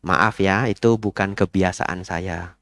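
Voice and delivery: quiet polite no; polite refusal. Retold or imitated. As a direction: decline politely and evenly. Monk politely declines.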